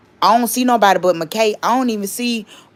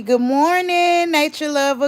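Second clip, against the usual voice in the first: bright drawn-out greeting; 7 semitones higher than usual. Real, not acted. Greeting a viewer by name.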